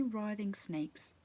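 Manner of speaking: usual speaking voice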